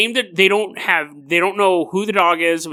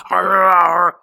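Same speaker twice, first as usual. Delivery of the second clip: loud, snarling "arr"; mock-ferocious snarl; savage dog growl; 5 dB louder than usual. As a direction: growl like a dog tearing into prey. Imitates dog savagely eating the mother.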